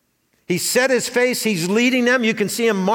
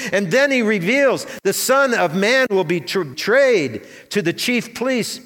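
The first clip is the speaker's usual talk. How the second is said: ordinary talk, nothing marked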